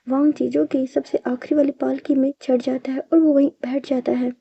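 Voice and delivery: plain reading voice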